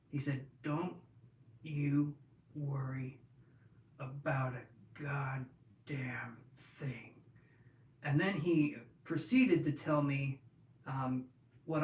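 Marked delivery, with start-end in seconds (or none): 0.6-7.1 slow hushed whisper. Retold or imitated. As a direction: breathe it slowly into his ear. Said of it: "I'll say it the way he said it".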